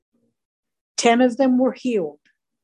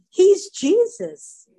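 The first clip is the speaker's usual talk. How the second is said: emphatic; 10 semitones higher, 4 dB louder, slower than usual. Real, not acted. Preacher's emphatic climax.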